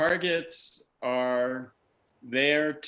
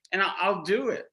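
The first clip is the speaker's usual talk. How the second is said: ordinary delivery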